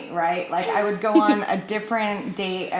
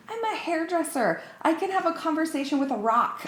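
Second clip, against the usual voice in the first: retorting; 9 semitones higher than usual. Retold or imitated. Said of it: "I'm like".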